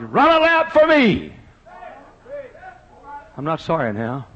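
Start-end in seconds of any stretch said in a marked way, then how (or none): 0.0-1.3 high, loud, slow joyful holler. Retold or imitated. Dying pastor urging the runners on, slow joyful holler. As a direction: holler it across the crowd.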